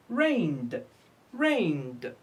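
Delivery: "rained" falling, ending in a voiced d; final d sound. Shown as a demonstration. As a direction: say "rained" as one syllable with E D as d. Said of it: "Why we pronounce E D".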